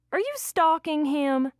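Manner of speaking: high, drawn-out incredulous question; accusing question. A narrator's reading. Producer confronting the ex about stalking, accusing. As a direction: question it with scandalized suspicion.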